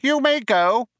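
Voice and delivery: very high, sharp mouse voice. A narrator's reading. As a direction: dismiss him sharply in a squeaky mouse voice. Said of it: "said Benji Mouse sharply".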